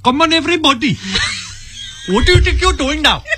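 high-pitched, hammed-up Indian-accented dad; mock Indian accent. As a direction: scold the kids in a high, put-on Indian accent. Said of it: "is an Indian accent".

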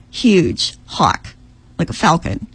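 usual speaking voice

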